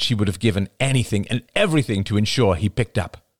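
ordinary delivery, nothing marked

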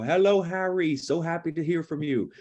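high-pitched mock English accent. Imitating mom's dry English boyfriend on the phone, English accent. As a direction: put on a playful, lilting English accent. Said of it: "That's my English accent".

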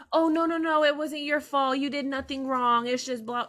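lightly voiced mother brushing it all off; imitating reassuring mom. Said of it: "she's gonna be like".

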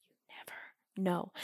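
soft whispered aside. Real, not acted. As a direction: let it fade to a whisper.

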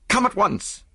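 high, alarmed command; frantic and pitched right up; urgent cry. A narrator's reading. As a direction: summon help in a panic. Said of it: "I cried".

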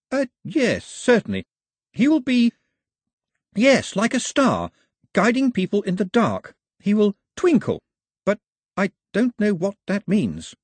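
bluffing his way through the song; flustered Pilu. Acted. Pilu improvising translation.

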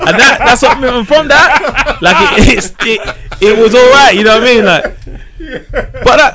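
high, loud, talking through real laughter; laughing. Real, not acted. Comic recalling his mum's phrase, laughing through it. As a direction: laugh while you get the words out.

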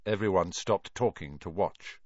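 ordinary delivery, nothing marked

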